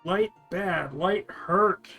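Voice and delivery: normal talking voice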